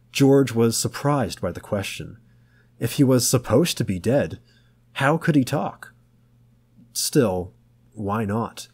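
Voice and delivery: plain reading voice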